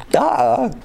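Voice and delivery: high, sarcastic duh, as if it were obvious; mock duh. Real, not acted. Teacher teasing the class over an obvious answer, sarcastic. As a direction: tease the class for missing it.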